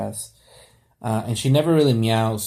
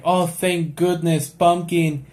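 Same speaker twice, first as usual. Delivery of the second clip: put-on high, pleading boy's cry for help; desperate Timmy in the well; 8 semitones higher than usual. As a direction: beg the cat to fetch help from the well. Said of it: "he would be like".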